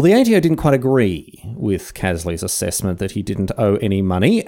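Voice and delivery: usual speaking voice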